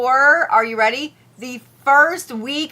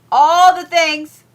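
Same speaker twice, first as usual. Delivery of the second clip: higher, louder, slow "All the things"; loud exclaim; 8 semitones higher, 11 dB louder, slower than usual. Real, not acted. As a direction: belt it out as a playful repeat.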